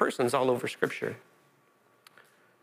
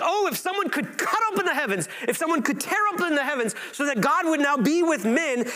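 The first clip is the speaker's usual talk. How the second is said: much higher, yearning prophet voice; longing prophet; 12 semitones higher than usual. Retold or imitated. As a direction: cry out for God to tear open the heavens. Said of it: "the prophets would be like".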